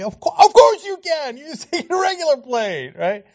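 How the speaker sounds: loud, high retort; shouting back at the preacher; exasperated outburst. Real, not acted. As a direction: burst out in disbelief.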